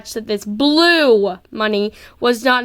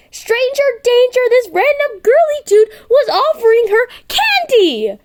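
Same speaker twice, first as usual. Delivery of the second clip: high, loud alarmed wail; mock panic; 13 semitones higher, 5 dB louder than usual. Girl panicking at a boy offering candy, high wail. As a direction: cry it out in melodramatic alarm. Kim panics about stranger.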